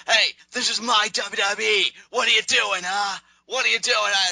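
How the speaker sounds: high, loud, indignant shout; angry yelling. Retold or imitated. Rollins yelling at Kane for not lying down. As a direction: demand answers at the top of your voice. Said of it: "Rollins really starts getting angry with Kane".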